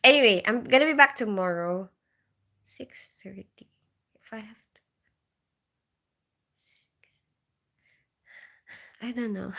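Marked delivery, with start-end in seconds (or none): 2.6-9.5 breathy whisper, barely there. Real, not acted. To her viewers on an early start, a grudging whisper. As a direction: mutter it under her breath like a reluctant aside.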